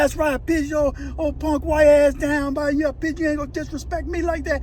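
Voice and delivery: high-pitched retold comeback; acted defiant gloating. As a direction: throw it back at him as the avenger. Acts out attacker boasting back, quarrel.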